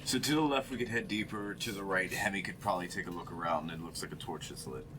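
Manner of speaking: normal talking voice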